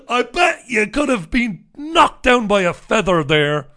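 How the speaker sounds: loud, high, hammed-up astonishment; sarcastic mock amazement. Host to listeners on broken cell cameras, mock amazement. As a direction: shout it as if badly faking shock.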